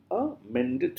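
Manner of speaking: "amended" split with a high, soft a apart from mended; syllable by syllable. Shown as a demonstration. Teacher showing three syllables, syllable by syllable. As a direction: separate the beats of "amended". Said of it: "three syllables".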